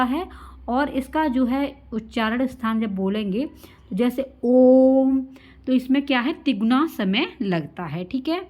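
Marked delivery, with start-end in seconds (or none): 4.4-5.2 ओम held three times as long, much louder; prolonged pluta vowel. Shown as a demonstration. Teacher to class, showing the pluta vowel, long and loud. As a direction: sustain ओम like a chant, long and loud. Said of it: "इसमें जो समय है तिगुना लगता".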